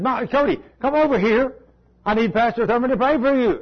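high calling-over voice of a mother. Retold or imitated. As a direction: call the boy back like a worried mom.

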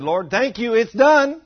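rising to a loud, high shout of victory on the last words; triumphant thanks. Retold or imitated. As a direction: declare it done like it already happened. Triumphant prayer of faith.

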